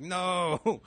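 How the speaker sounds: mock protest. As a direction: imitate a caught-out texter protesting. Acted reply of exposed texter.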